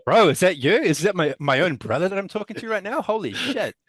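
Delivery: high, loud, over-the-top delight; mock amazement. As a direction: gush like you've found a long-lost brother. Mock amazed reaction to the impression.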